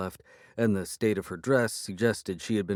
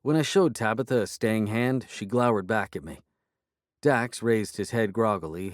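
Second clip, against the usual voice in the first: ordinary delivery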